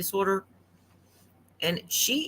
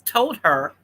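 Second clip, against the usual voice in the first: mouth full; 3 semitones higher, 5 dB louder than usual. Real, not acted. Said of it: "sorry, I'm eating".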